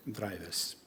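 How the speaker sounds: ordinary talk, nothing marked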